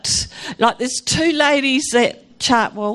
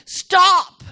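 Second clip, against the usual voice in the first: mild mock scream, higher pitched; raised-pitch retold yell, held back; 5 semitones higher, 3 dB louder than usual. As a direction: imitate a desperate cry to stop. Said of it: "I was screaming".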